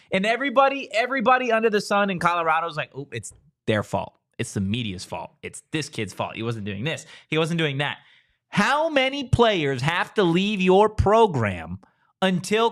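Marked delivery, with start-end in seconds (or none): 2.9-8.0 low, quieter mock blame-shifting; mock litany of excuses in a lowered voice; mimicking excuse-makers. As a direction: imitate fans reeling off excuses, pitched down and softer. Mimicking fans making excuses.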